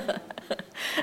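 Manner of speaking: soft breathy chuckle; amused laughter after a joke. Real, not acted. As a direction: let a light laugh trail out. Teacher laughs at own joke.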